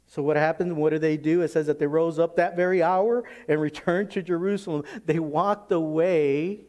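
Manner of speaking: loud, building sermon voice; preaching. Real, not acted. Preaches at full force.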